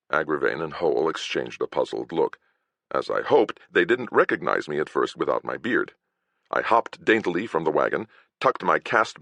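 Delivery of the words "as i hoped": ordinary delivery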